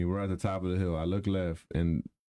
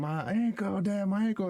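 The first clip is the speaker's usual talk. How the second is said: high, pained child's cry, imitated; imitating screaming kid; 10 semitones higher than usual. Dad imitating his hurt daughter crying about her ankle, high wail. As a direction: mimic a hurt little girl wailing. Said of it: "she's screaming".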